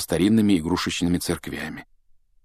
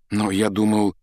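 ordinary delivery, nothing marked

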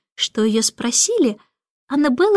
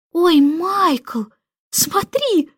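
put-on higher, thrilled girl's voice; delighted cry; 6 semitones higher, much slower than usual. A narrator's reading. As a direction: call out in delight. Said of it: "восторженно закричала Джейн".

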